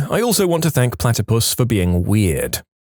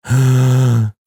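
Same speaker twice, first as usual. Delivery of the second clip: loud, long intake of breath; big gasp; 5 dB louder than usual. Pretend. Before reading an absurdly long village name, loud gasp. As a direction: suck in a huge breath.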